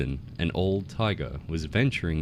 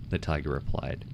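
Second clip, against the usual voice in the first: usual speaking voice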